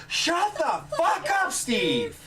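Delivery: high-pitched cartoon telling-off; Claptrap. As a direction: squawk it slowly, dropping on the name. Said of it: "Claptrap goes".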